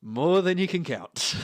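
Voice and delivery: high, loud, gleeful grin; grinning reply. Acted. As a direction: relish the bad news with a grin. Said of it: "just grin at you and say".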